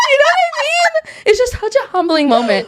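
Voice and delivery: laughing. Real, not acted. Still laughing, high.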